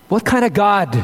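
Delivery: ordinary talk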